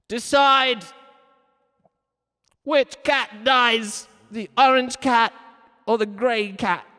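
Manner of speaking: loud, slow booming decree; hammed-up, high and forceful command; dramatic ultimatum. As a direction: proclaim a grave choice, loud and drawn out.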